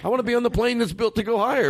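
high-pitched incredulous rant; can't believe he said that; exasperated. Real, not acted. Exasperated rant about pilot.

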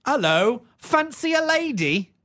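high, drawn-out Cockney come-on; Cockney prostitute. Retold or imitated. Streetwalker propositioning a man in London, high Cockney imitation. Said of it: "chimney sweep voice".

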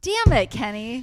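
loud high wail of frustration; frustrated yell. Real, not acted. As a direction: cry out in mock despair. Groaning at the spoiled answer.

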